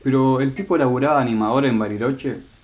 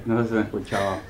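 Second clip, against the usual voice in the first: ordinary talk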